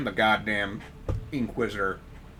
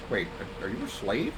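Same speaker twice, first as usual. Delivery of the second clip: usual speaking voice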